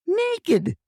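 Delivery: high-pitched cry of Naked; grandma's high mock yell at rooftops. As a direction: call out Naked high and sharp. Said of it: "she yelled".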